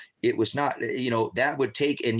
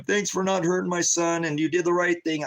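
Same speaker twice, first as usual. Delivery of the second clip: higher, falsely grateful voice; playing dumb; 7 semitones higher than usual. Father faking gratitude to the officers who shot his son, mock-grateful. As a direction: fake thanks to the police, voice lifted. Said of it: "So I had to play stupid".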